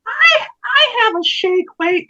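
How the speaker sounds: put-on very high, slow drunk lady voice; drunk woman. Drunk lady in the front row heckling, very high and slow. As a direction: squeak it out like a tipsy woman. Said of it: "She's like".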